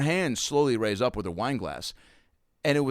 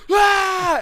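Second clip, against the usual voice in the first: very high, loud victory cry; triumphant shout; 10 dB louder than usual. Retold or imitated. The comic showing off the caught glass, very high loud cry. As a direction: hold the glass up and crow. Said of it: "And then I just went".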